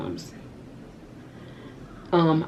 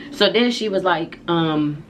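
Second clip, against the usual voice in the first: usual speaking voice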